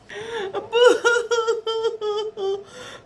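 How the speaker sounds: high, long pretend bawling; hammed-up storybook boo-hoo; mock crying wail. A narrator's reading. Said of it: "cried Bird's friends together".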